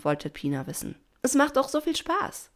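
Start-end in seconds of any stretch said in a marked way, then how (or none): 1.2-2.5 put-on high, lively young voice; eager young dolphin. A narrator's reading. Pina argues with Doña Rosa, high and eager.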